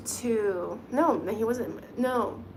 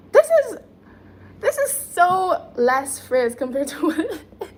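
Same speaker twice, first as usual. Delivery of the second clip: laughing; 7 semitones higher, 9 dB louder than usual. Real, not acted. Laughing about her frizzy hair.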